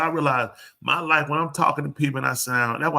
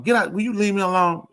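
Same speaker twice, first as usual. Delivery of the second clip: higher, sharp irritated brush-off; 6 semitones higher than usual. Retold or imitated. Wife brushing off husband who wants to talk, irritated. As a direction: snap at him to leave you alone.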